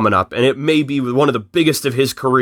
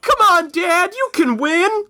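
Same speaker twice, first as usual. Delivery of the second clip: very high put-on kid voice; high-pitched pretend cheer, no real volume; mock child's yell. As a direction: squeal it like a son cheering on his father. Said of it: "yelling out".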